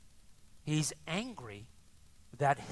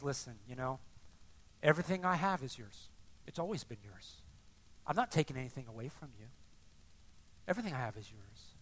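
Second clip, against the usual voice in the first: low, soft fatherly reassurance; very calm; 5 semitones lower, 6 dB quieter, faster than usual. Acted. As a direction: gently reassure an angry son. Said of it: "Very calmly says".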